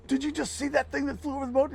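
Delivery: high, amazed ask to a buddy; can't believe what just flew past; astonished question. Retold or imitated. Asking his buddy Lewis in high-pitched disbelief.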